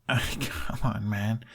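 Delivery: soft laughing protest. A narrator's reading. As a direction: laugh it off softly. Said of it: "he laughed".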